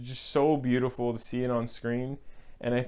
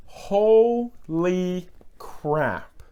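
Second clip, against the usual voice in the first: excited; 6 semitones higher, 5 dB louder, much slower than usual. Real, not acted. Excited exclamation about actor.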